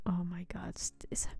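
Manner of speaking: unvoiced, awed whisper. Real, not acted. Player reacting to news, whispered. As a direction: breathe it in disbelief. Awed aside while reading.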